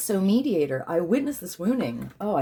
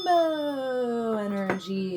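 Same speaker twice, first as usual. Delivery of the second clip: drawn out; 8 semitones higher, much slower than usual. Real, not acted. Drawn-out ritual phrase.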